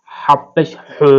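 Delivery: slow "হু" squeezed from mid-throat; tight, breath-heavy Arabic h on "হু"; pressed mid-throat h. Shown as a demonstration. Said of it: "হলকের মধ্যখান থেকে চেপে পড়তে হবে".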